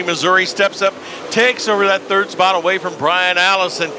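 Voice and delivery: rising excitement as a driver charges; motorsport commentator. Acted. Live race play-by-play call.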